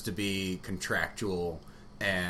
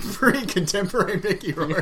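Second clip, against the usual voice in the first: laughing; 9 semitones higher, 4 dB louder than usual. Real, not acted. Joking about the actor's looks, laughing.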